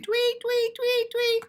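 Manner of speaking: high chirpy birdsong tweets; sing-song tweeting bird call. Acted. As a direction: chirp it like a little songbird. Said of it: "those birds always are singing".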